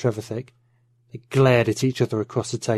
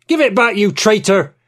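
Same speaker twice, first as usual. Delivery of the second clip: put-on much higher and louder, an old man's outraged yell; furious shout; 9 semitones higher, 7 dB louder than usual. Old man demands his stick back from the traitor, shouting. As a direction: bellow it as he lunges for his stick.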